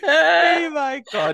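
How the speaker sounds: high, slow, tearful laughter; crying with laughter. Real, not acted. Tearful laughing at her friend's taxi story. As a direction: laugh till it breaks.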